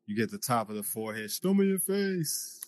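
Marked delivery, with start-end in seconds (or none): 1.3-2.6 slow, high demanding call; drawn-out pleading caller; imitating a caller. Mimicking a FaceTime caller.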